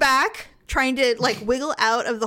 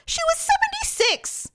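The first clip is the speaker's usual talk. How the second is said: indignant retort. Retold or imitated. Retold retort to husband.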